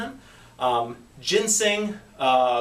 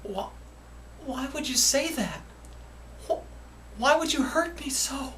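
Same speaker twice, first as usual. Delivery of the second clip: hurt; 8 semitones higher, 6 dB quieter than usual. Acted. Skit, hurt by a remark.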